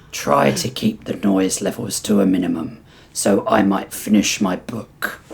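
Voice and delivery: low, measured reptile voice; weary, polite reptile prisoner. Acted.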